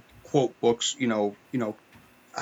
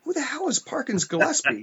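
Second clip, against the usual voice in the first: higher-pitched retort, quoting dad; imitated baffled father; 11 semitones higher than usual. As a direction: act out dad's puzzled question. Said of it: "my father is like".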